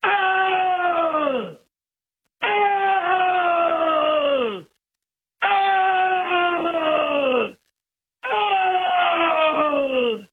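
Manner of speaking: long, high wail sliding down at the end; high drawn-out howl, each on one breath; imitated animal cry. Sheep herder imitates what he heard from the canyon, long high animal cry. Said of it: "I heard him go".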